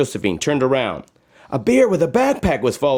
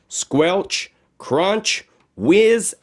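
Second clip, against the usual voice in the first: climbing higher on each sound; rising sound recap; 7 semitones higher, much slower than usual. A narrator's reading. Narrator recapping the trip with rising sound words. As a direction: build the list playfully.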